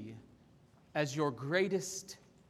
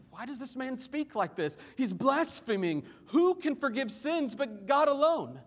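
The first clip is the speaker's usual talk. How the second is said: indignant questioning; 7 semitones higher, 3 dB louder than usual. Acted. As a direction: question it as scandalized scribes, building in force. Voices scribes' indignant questioning.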